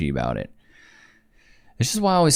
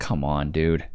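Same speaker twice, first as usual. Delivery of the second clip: exasperated sigh; 7 semitones lower, 5 dB quieter, slower than usual. Real, not acted. Commentator fed up with the wife, low and soft. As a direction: lower and softer, fed up.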